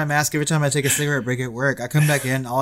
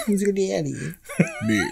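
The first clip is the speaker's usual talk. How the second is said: higher-pitched mock English accent; put-on English accent; 5 semitones higher than usual. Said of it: "slip into an English accent".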